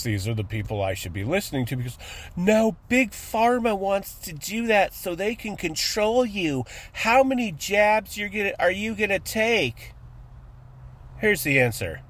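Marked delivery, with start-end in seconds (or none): 2.4-9.9 lightly voiced higher know-it-all; mocking anti-vaxxer. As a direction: parody a know-it-all warning. Mocking anti-vax people.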